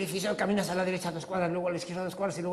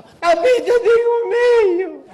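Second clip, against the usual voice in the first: put-on much higher, louder child's whine; little boy voice; 16 dB louder than usual. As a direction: whine like a scared toddler.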